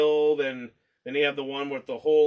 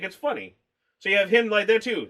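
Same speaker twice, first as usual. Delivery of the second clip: ordinary delivery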